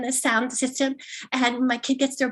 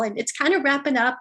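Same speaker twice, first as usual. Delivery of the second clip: usual speaking voice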